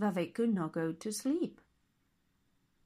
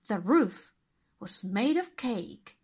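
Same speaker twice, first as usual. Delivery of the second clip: ordinary delivery, nothing marked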